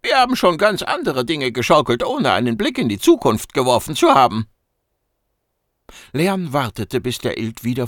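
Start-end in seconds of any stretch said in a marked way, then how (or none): 0.0-4.5 much higher, squeaky cocky voice; a small furry know-it-all teasing; cheeky mouse-beaver. Acted. Gucki needling the agent, squeaky high and cocky.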